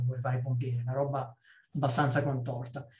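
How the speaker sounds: ordinary talk, nothing marked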